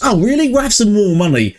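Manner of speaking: high, amazed squeal of delight; mock surprised customer. Customer told McDonald's takes a loss, hammed-up surprise. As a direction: gush like a customer who can't believe it.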